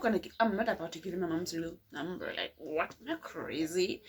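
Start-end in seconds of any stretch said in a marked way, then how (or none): none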